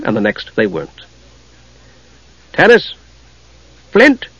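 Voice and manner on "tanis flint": high, questioning calls; calling out. A narrator's reading. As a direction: call after departing friends, pitch raised. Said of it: "called their names".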